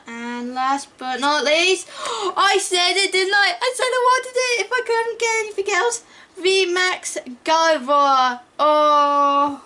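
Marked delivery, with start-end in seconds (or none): 1.9-9.6 excited gasp. Real, not acted. Gasps at pulling wanted card.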